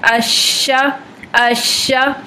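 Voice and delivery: अश्शा with spreading hissed sheen; sheen demo. Shown as a demonstration. Teacher demonstrating sheen again. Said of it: "समझ पाते हैं कि इसका मखरज".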